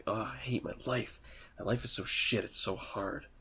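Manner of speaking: soft, understated mock misery; lightly voiced despairing businessman, hushed; upset businessman. Upset businessman moaning alone at the park, soft mock despair. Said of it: "like, this upset businessman".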